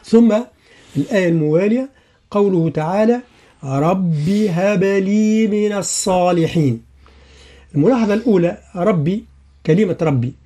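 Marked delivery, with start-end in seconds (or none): 3.6-6.8 slow Quranic chant; Quran recitation. Shown as a demonstration. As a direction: recite the verse with full tajweed. Recites the verse. (تلاوة مجودة بطيئة)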